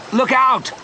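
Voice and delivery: high, urgent warning shout. A narrator's reading. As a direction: shout a sudden warning, pitch leaping up. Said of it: "Leon shouted".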